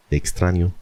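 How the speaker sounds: ordinary talk, nothing marked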